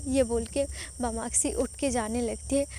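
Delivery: ordinary delivery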